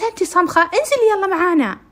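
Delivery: high, loud irritated shout; loud scolding are-you-deaf; impatient yell. Retold or imitated. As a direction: yell at her to come down. Amal snaps at the deaf-seeming girl.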